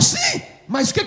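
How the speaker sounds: father demanding what Zaqueu has to do with him, pitched high; father's retort. Retold or imitated. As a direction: fling the question up high at the son. Father challenges son in retold scene.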